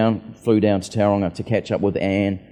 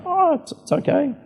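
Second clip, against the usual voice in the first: much higher, squeaky embarrassed reply; mock your own flustered politeness, pitched way up; awkward polite brush-off. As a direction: speaker awkwardly declining to hear the story, in a much higher mock voice. Speaker mimics his own awkward reply.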